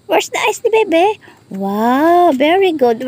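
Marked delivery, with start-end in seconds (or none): 0.0-1.1 put-on high, quick question to the baby; high quiz. Shown as a demonstration. Grandmother asking the baby again, higher and quicker. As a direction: lift "eyes" high and quick. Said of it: "quiz game with baby".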